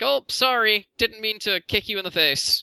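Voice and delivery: high, loud Luffy-style oops apology; mock apology. Luffy to Doflamingo after kicking Bellamy, high mock apology. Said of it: "So Luffy's like".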